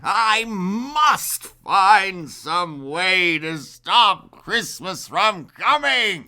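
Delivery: slow, scheming Grinch growl. Acted. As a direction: drag it out like a plotting villain. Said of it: "he growled with his Grinch fingers".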